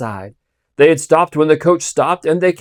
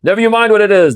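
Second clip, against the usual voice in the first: loud, high hostile comeback; sharp retort; 7 semitones higher, 6 dB louder, faster than usual. Acted. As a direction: snap back at the voice in the mist. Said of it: "the guard retorted".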